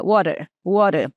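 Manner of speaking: water, water with a fast flapped T. Shown as a demonstration. Teacher repeating water to show the flap. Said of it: "falada de forma mais rápida".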